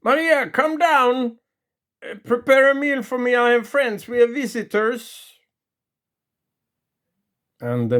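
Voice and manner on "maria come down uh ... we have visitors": high, loud bellow up the stairs; hollering for his wife, fast; drunken shout. Retold or imitated. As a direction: call her down like a boss. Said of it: "he shouts".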